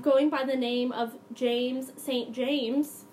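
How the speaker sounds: ordinary talk, nothing marked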